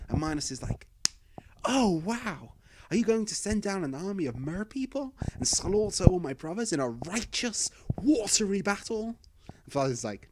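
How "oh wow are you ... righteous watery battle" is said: higher, hopeful and eager; eager excitement. Acted. Minos begging Poseidon for a bloody plan, eagerly excited. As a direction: pitch it like an excited kid.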